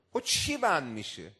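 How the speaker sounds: normal talking voice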